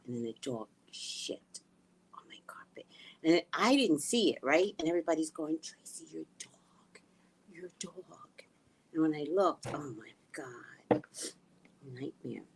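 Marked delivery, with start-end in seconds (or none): 0.1-2.9 hushed whisper; whispering the confession. Retold or imitated. Telling viewers about the dog, in a whisper. 5.6-8.4 slow hushed whisper; imitating whispered warning. Guests warning Tracy about the dog, whispered slowly. 9.7-12.4 whispered dismay. Retold or imitated.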